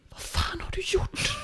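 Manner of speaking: acted aghast stage whisper; horrified whisper. Girlfriend seeing the bathroom mess, appalled acted whisper. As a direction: whisper it in shock, hand over mouth. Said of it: "täcker munnen och näsan och viskar".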